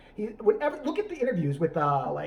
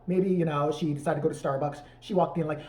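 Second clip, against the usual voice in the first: ordinary delivery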